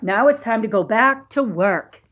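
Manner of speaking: plain reading voice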